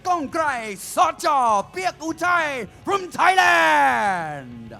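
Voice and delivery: high booming name call; ring announcer shout. Acted. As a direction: belt out the name to the crowd. (ตะโกนประกาศชื่อนักมวยเสียงดัง)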